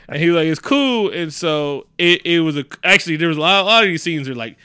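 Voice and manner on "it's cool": high, easy-going quote. Retold or imitated.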